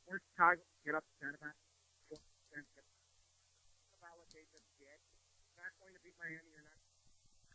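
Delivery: breaking up over a bad phone line; phone cutting out. Real, not acted. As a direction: voice dropping out on call. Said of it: "We lost you".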